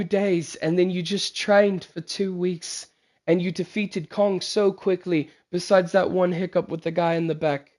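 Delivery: measured royal character voice; stately king. Acted. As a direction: praise the heroes as their formal king. Voices King Teja addressing the heroes.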